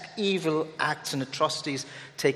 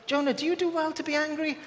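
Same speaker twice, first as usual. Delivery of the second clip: God's gentle question; 12 semitones higher than usual. Retold or imitated. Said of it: "And God comes and he says".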